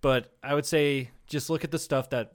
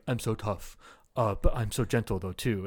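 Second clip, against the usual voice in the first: a hint of a soft, lower smug voice; mock Hapkido guy; 6 semitones lower, 5 dB quieter than usual. Mocking the Hapkido guy's boast, lightly softened. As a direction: lightly send up his gentle boast. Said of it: "Hapkido guy's like".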